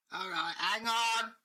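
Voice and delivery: a grumbling servant agreeing to fetch the boss; gruff doorkeeper. Acted. Doorkeeper telling Dave to wait, slow and loud.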